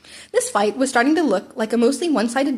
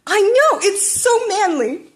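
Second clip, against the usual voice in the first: high, loud, slow excited squeal; 10 semitones higher, 5 dB louder, slower than usual. A narrator's reading. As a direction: squeal it like a fanboy. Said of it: "practically squealed".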